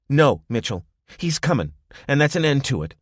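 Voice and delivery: firm insistence. Acted.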